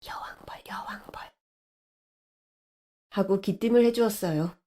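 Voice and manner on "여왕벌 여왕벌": secret whispered warning; breathy tip-off under the breath; hushed whisper. Acted. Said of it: "나지막한 소리로".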